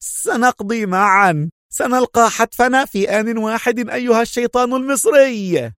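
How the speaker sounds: high-pitched, manic villain's cry; deranged doomed man's high outburst; crazed. A narrator's reading. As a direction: exclaim madly, voice held high, stretching the opening. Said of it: "ضحكات هنري الجنونية وهو يهتف".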